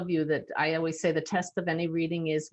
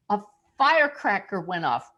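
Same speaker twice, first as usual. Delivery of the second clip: ordinary talk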